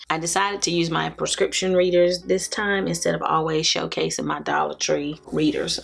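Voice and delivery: usual speaking voice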